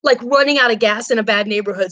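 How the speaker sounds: normal talking voice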